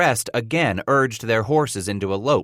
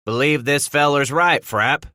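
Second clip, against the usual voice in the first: mild holler, slightly raised; 4 semitones higher, 3 dB louder than usual. A narrator's reading. Trapper to companion over hot wind, hollering. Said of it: "Bridger hollered into the dry, hot wind".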